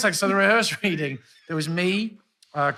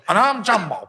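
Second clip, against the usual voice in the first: louder, higher announcer-style catchphrase; catchphrase impression; 5 semitones higher, 8 dB louder than usual. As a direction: deliver it like a TV jingle. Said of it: "And then he goes".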